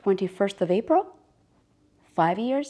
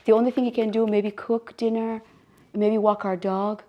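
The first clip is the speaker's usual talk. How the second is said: usual speaking voice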